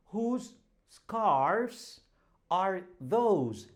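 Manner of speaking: ordinary talk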